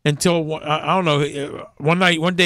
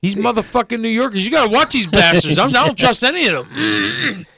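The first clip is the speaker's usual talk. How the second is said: mock outrage; 6 semitones higher than usual. Mock-hostile rant about New Yorkers.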